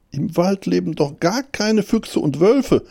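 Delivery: high, insistent little hare voice; self-persuading hare. A narrator's reading. Hare telling himself the forest is safe, high insistence. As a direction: convince yourself, pitched high. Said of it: "sagte der Hase zu sich selbst".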